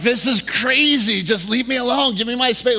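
loud, high-pitched crowded-out complaint; exasperated. Retold or imitated. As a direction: vent like a fed-up shopper. Acted fed-up shopper in crowd.

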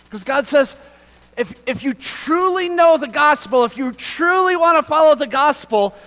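loud, high preacher's cry, full force; fervent preaching. Acted. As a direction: preach it out to the whole room, building to a shout.

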